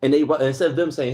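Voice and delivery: ordinary talk, nothing marked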